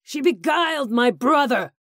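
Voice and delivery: high, loud, raging scream. Acted. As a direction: shriek out the accusation in hatred. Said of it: "he screamed".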